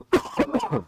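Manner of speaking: short real cough; sudden cough mid-sentence. Real, not acted. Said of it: "excuse me".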